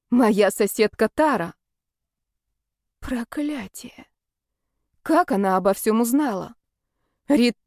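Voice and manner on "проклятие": soft slow breathless whisper; whispered curse. Acted. Curse on reading the article.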